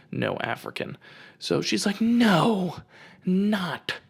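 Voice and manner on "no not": high, loud imitated protest; hammed-up emphatic rejection; exasperated denial. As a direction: cry out the correction as the stranger. Lady rejecting the wrong guess.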